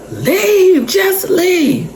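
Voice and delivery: imitating a young son's very high, drawn-out cry; squeaky plea. Son bursts in telling the man to leave, high squeaky plea. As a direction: wail it in a little squeaky voice.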